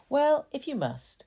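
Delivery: ordinary delivery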